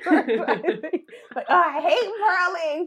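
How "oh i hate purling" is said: high, mock whiny complaint; whiny knitters. Imitating knitters who complain about purling, high and mock whiny. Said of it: "People, like, say".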